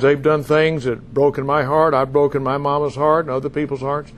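usual speaking voice

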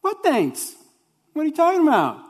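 high, innocent play-dumb voice; Jesus feigning ignorance with a wink; playful mock ignorance. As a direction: act like you've heard nothing at all. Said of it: "Jesus responds with what I think is just a hilarious response".

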